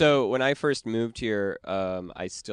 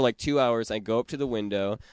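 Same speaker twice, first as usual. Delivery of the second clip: ordinary delivery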